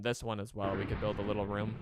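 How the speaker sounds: ordinary talk, nothing marked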